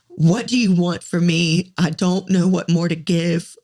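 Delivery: usual speaking voice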